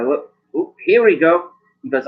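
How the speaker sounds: ordinary talk, nothing marked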